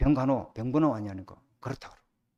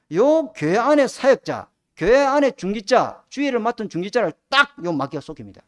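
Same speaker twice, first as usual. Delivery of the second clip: emphatic preaching; 2 semitones higher, 6 dB louder than usual. Real, not acted. Preacher hammering home a warning.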